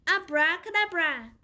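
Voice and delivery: loud spell call; shouted spell. A narrator's reading. Winnie shouting the spell to change her skateboard. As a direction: call out the magic word. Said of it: "and shouted".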